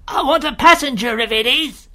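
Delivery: high, loud distant holler; calling from afar. A narrator's reading. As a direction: rider shouting through the mist to the coach. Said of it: "A man's voice called from the mist".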